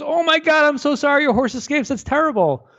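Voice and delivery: high, gushing neighbor voice; hammed-up sympathetic neighbor; fussing neighbor.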